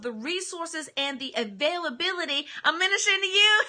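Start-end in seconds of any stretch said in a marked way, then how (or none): 2.6-3.7 breaks into a loud laugh mid-line; bursting into laughter while preaching. Real, not acted. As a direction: laugh out the words.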